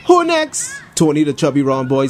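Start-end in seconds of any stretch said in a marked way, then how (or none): 0.1-0.8 put-on high, challenging boy with a light foreign accent; a hint of a foreign Caribbean accent; foreign island accent. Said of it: "said in an accent".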